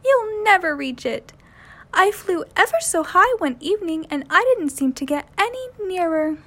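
high, light bird voice; a bright little bird asking; chirpy little wren. A narrator's reading. Jenny Wren asks Willie where he's going, high and chirpy. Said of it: "cried Miss Wren".

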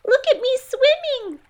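very high squeaky little voice; squeaky Roo. A narrator's reading. Roo showing off while swept downstream, squeaky high. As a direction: squeak it like a proud tiny joey. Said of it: "squeaked Roo".

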